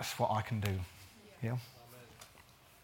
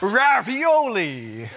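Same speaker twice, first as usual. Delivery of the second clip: slow, loud mock Italian accent; playful Italian accent, drawn out; 6 semitones higher, 8 dB louder, much slower than usual. Shown as a demonstration. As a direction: savour it like an Italian waiter. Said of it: "You've got to say it with an Italian accent".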